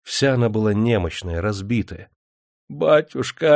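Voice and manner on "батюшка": higher, louder and drawn out; cling to him with the word Батюшка; feverish plea. A narrator's reading. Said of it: "горячечно прошептала она". (горячечная мольба)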